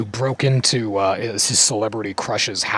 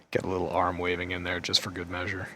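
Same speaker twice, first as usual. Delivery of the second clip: ordinary talk, nothing marked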